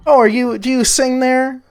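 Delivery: lightly voiced high old lady; old woman. Elsa picks up the gold and asks about the tune, light old-woman voice.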